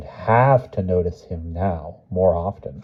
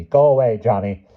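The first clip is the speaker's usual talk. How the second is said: dismissive grandma; 3 semitones higher, 5 dB louder than usual. A narrator's reading.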